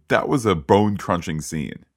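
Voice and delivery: ordinary talk, nothing marked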